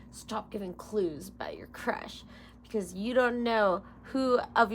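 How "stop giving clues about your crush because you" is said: hushed secret whisper. Acted. Vlogger whispering a warning to herself about her crush. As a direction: breathe it like a secret.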